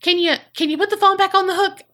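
high, fast called-out plea; hammed-up high shout to the room; retold yell. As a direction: yell it up through the door, mock urgent. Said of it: "He just kind of yells".

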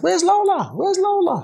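high-pitched imitation of children asking; mimicked kids. Kids always asking about Lola, high mimicked children's voices.

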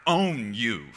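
slow mock possessive declaration; bad owner. Preacher mimicking someone addressing a prized possession, domineering. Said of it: "do you walk up to it and go".

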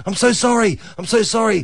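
high, apologetic woman voice; lightly voiced flustered dog owner. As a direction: pitch up and gush apologies. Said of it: "this woman appears going".

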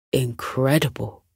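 a lightly voiced father, impressed; father's voice. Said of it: "said Elliot's father".

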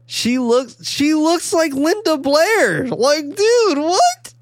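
very high, drawn-out disbelief; astonished squeal. Real, not acted. Podcaster marvelling at the actress's lookalike, squealing in disbelief. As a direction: squeal it in sheer amazement. Freaking out over the resemblance.

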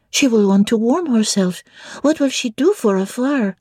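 put-on high child voice asking in French; little French girl. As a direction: ask it like an eager little girl. Adele asks.

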